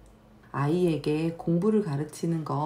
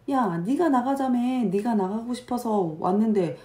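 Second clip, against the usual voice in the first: high, loud irritated yelling; angry mom; 6 semitones higher, 6 dB louder than usual. Retold or imitated. Mom losing her temper at the child in the restaurant, high and loud. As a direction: snap back at the whining child. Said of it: "하고 엄마도 같이 화를 내겠죠".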